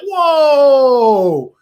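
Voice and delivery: loud, high, long-held "whoa"; big retold cry of amazement; shocked whoa. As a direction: let it ring out and slide down. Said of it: "I was like".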